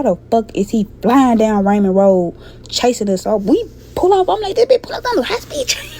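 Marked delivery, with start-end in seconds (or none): 4.6-5.9 high-pitched, amazed cry; incredulous exclaim. Retold or imitated. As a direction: squeal it in disbelief. Exclaiming as he chases her car.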